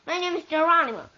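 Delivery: loud, fast, high insistence on "Geronimo"; angry insistence. Acted. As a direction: fire "Geronimo" off quickly and firmly. Angry name quarrel.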